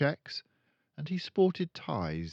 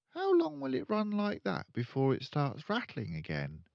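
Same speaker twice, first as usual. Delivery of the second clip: put-on higher, questioning child voice; curious girl; 8 semitones higher than usual. Matilda quizzing her father, curious child voice. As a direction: probe innocently. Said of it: "Matilda asked him".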